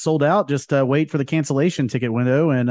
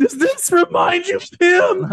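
much higher, slow put-on voice; high squeaky voice; 3 dB louder, slower than usual. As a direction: squeak it like a cartoon. Mocking ice cream truck voice.

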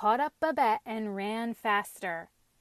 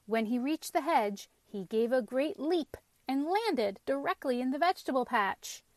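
usual speaking voice

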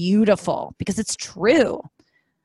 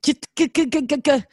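high, quick shoo; mock panicked shooing; 8 semitones higher, 3 dB louder than usual. Woman retelling shooing her ex from her hair. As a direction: swat him away in a panic.

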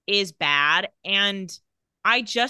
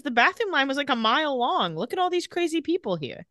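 higher-pitched mock complaint; exasperated excuse; 6 semitones higher than usual. He explains where he has been, exasperated. Retold excuse about long line.

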